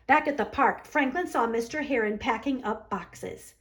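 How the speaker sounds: ordinary delivery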